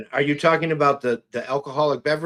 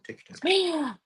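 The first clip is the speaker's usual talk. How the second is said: high mock voice; 4 dB quieter, slower than usual. Joking reassurance, high voice.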